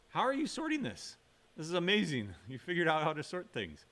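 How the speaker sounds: high-pitched pretend astonishment; mock amazement. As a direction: act amazed at a simple feat. Teacher feigns amazement at students sorting.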